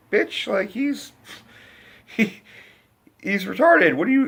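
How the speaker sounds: high-pitched, sputtering mock outrage; imitating a shocked viewer, stumbling over the words; incredulous protest. Viewer silently objecting to the mother's scolding, high-pitched mock indignation.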